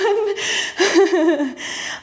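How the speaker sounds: high, winded chuckle; self-mocking laugh. Real, not acted. Coach unable to talk and exercise at once, winded laugh. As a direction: laugh helplessly at your own struggle.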